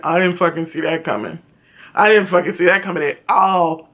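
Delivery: low-pitched impression of mom's reaction; imitating mom. Mom watching the show with the teller, imitated low surprise. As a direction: drop low to quote mom. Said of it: "and my mom went".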